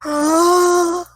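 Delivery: loud, voiced gasp; mock shocked gasp. Player reacts to dragon line.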